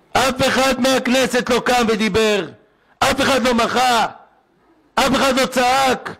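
high, slow, drawn-out outcry; crying out in protest. Real, not acted. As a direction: cry out against the silence. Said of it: "אני צועק".

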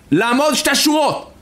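high-pitched soldier's command; shouted German order. Retold or imitated. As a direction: call out the order to a whole crowd. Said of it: "הגרמני צועק". (פקודה בצעקה של חייל גרמני)

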